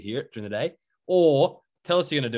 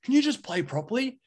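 high, put-on whiny kid; 11 semitones higher than usual. Kid at the park complaining to the ball smacker, high whiny kid voice. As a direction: mimic a fed-up kid at the park. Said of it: "the other kid goes".

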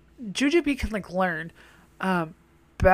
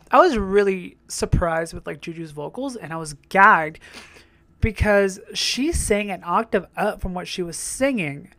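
usual speaking voice

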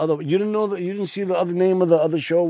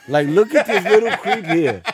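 laughing; 5 semitones higher, 5 dB louder than usual. Real, not acted. Laughing at friend's watch list.

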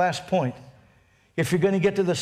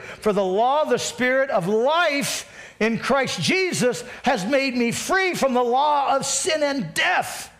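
high, driving recitation; scripture preaching; 5 semitones higher than usual. Acted. Pastor reciting Romans eight to the congregation, forceful preaching. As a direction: declaim it high and relentlessly from memory.

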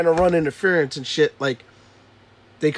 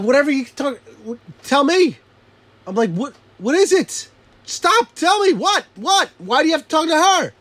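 higher, pleading frustration; exasperated dad; 9 semitones higher than usual. Retold or imitated. Frustrated dad pleading with child to tell him instead, higher. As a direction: beg the kid to just tell you.

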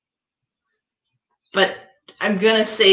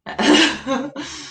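short laugh; 8 semitones higher than usual. Real, not acted.

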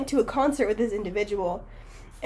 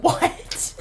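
laughing whisper. Retold or imitated. Retelling her reaction.

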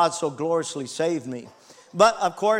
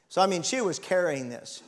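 ordinary talk, nothing marked

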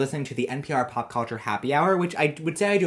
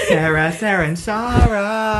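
hammed-up intro; 6 semitones higher, 6 dB louder, slower than usual.

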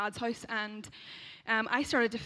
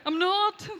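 high, loud mock protest; protesting denial; 9 semitones higher, 9 dB louder than usual. Accused of being weird by her roommate, high indignant denial. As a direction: deny it loudly, pitched right up. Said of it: "And I'm like".

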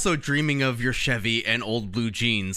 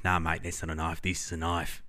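put-on Australian accent; 9 semitones lower, 7 dB quieter than usual. Friend quoting the movie in a mock Australian accent. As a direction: lower mock Australian accent, Crocodile Dundee style. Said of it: "Crocodile Dundee".

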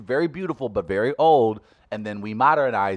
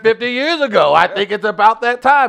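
mock announcer voice; 11 semitones higher, 10 dB louder than usual. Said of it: "And you go".

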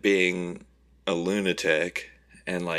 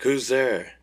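raised wary call; 7 semitones higher, 4 dB louder than usual. Retold or imitated. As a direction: call out into the dark house. Calling out in dark house.

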